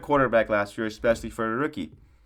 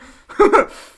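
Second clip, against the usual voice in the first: loud burst of laughter; 11 dB louder than usual. Real, not acted. Cracks up at own bit.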